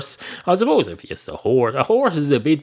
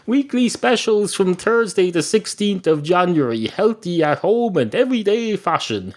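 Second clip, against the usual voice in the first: mock ad read; 5 semitones higher than usual. Shown as a demonstration. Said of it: "there's an email from Lidl".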